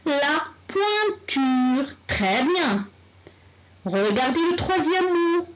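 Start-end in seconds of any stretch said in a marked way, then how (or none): 0.1-1.9 La pointure, drawn out with a slow, clear poin-ture; very slow, careful model word; slow model reading. Shown as a demonstration. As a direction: model it slowly for the class.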